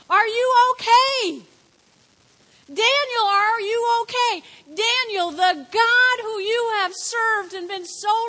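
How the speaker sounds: high, loud desperate calling out; a king running and yelling for his friend; frantic yelling. Acted. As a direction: shout his name across the den in panic. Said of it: "he's yelling".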